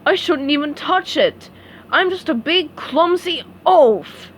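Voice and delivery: a lightly voiced glum starfish; self-pitying Patrick. Patrick tells SpongeBob he is a clumsy oaf, mild self-pity.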